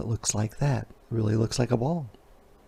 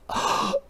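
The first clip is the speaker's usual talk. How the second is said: fearful gasp; 3 dB quieter than usual. Acted. Teller acting fright.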